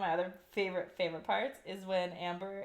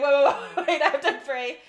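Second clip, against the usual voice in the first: flustered wait; 4 semitones higher, 9 dB louder than usual. Retold or imitated. Flustered lover stopping mid-scene.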